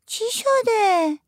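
put-on very slow, high, scared child's question; frightened little girl. A narrator's reading. Frightened girl grabs her aunt's hand and asks what happened. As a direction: ask it slowly like a scared small girl. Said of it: "آذین که ترسیده بود".

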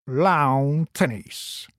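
لاون said slowly to rhyme with داون; pronounced lawn. Acted. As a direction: drag out لاون تنیس, rhyming لاون with داون. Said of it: "لاون را بر وزن داون تلفظ".

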